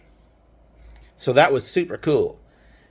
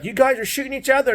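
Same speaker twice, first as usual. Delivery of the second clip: angry dad; 9 semitones higher, much faster than usual. Retold or imitated. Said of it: "and it's like".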